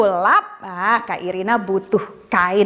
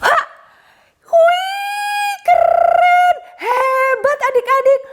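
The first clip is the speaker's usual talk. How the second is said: excited praise; slower than usual. Acted. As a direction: cheer the kids on, thrilled. Trick succeeds, praising kids.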